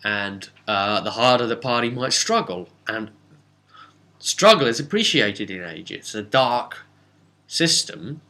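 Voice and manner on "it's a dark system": ordinary talk, nothing marked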